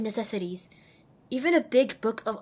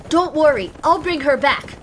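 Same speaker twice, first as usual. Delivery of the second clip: louder, higher defiant call; defiant reply; 5 semitones higher, 7 dB louder than usual. Acted. Pip calling back to the Overmare, defiant and loud. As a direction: shout back with resolve. Pip answers over speakers.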